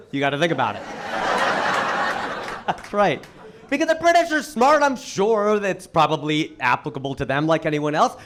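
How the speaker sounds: ordinary delivery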